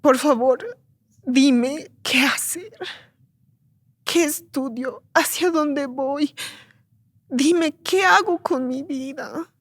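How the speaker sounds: ruego agudo y muy lento, con pausas; súplica llorosa recreada. Retold or imitated. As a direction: preguntarle a Dios como quien está perdida. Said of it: "y yo lloré y le dije". (súplica desolada, arrastrando las palabras)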